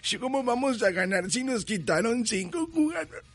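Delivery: high, whiny mock sob of a sore loser; mock crying fan. Host mocking rival fans who cried all week, high whiny mock sob. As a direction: whimper it like a sulking fan. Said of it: "andaban llorando entre semana".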